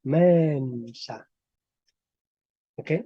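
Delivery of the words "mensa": "Mensa" with a long, drawn-out open E; slow "Mensa", lingering on an open eh. Shown as a demonstration. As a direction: stretch the E of "Mensa" wide open, like "meh". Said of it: "me daría la E abierta".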